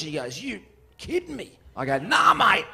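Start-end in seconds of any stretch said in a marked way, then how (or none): 0.4-1.5 high-pitched put-on disbelief; mock incredulity. Pub bloke disbelieves him. 2.0-2.7 loud, high acted denial; emphatic denial. Acts out his emphatic denial.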